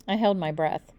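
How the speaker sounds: usual speaking voice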